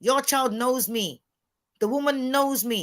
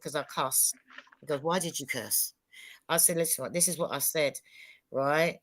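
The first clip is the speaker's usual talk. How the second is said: softer, lower, replaying a private chat; hushed retold exchange; 7 semitones lower, 8 dB quieter than usual. Wife retelling a private chat with her man, hushed. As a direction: murmur it like recounting a talk at home.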